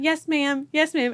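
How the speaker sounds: put-on meek little-girl reply; chorusing daughters. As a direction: imitate scolded girls answering their mom. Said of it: "the girls are in the back going".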